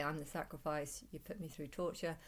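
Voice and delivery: ordinary talk, nothing marked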